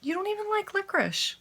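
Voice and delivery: squeaky voice for the dog; very high pet-talk voice. Real, not acted. Talking to the dog again.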